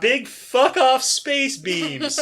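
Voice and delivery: loud, high, stretched-out words; gleeful relish. Real, not acted. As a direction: savour each word slowly and loudly. Relishing the ship's giant guns.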